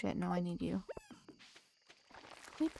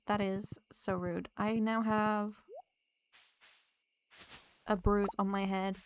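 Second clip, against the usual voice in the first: normal talking voice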